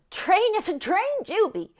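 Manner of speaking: high, loud cry of alarm; frightened child's yell. Retold or imitated. Little sister warning of the coming storm, yelling. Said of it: "Amy was yelling".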